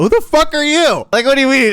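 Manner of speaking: high-pitched indignant challenge; outraged. Real, not acted. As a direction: burst out high in disbelief. Arguing with someone defending him.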